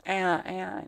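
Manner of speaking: small, high mock baby wail; imitated newborn cry. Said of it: "kalau lahir nggak nangis, dipukul dadanya".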